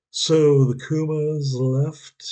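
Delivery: ordinary talk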